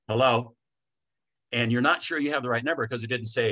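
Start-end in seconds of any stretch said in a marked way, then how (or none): 0.1-0.5 curt, slightly loud hello; brusque phone hello. Shown as a demonstration. Shop worker answering a customer's call, brusque and aggressive. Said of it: "that aggressive tone of voice".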